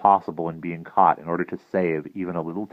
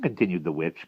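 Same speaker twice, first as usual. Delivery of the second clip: usual speaking voice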